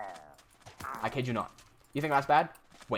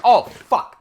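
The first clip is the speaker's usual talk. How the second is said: sudden loud dismayed curse; shocked curse; 5 semitones higher, 11 dB louder than usual. Retold or imitated. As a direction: blurt it out, startled and loud. Shocked discovery.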